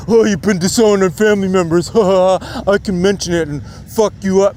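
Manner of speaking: sneering imitation of a hater's dare, with a laugh; mocking taunt. Mock taunt of a hater speaking to the streamer, with a laugh. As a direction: mimic a smug commenter daring him.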